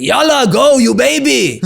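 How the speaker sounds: high, loud barked order; soldier screaming. Retold or imitated. Retired IDF soldier screaming at the scared rappeller. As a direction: yell it like a drill soldier hurrying you. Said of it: "retired I D F soldier screaming at you".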